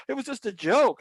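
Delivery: high, whiny mock protest; imitated manager squealing an excuse; panicked excuse. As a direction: back off pleading innocence. Manager backing off, retold.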